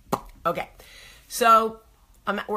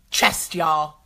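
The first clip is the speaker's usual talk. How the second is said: normal talking voice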